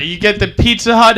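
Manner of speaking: normal talking voice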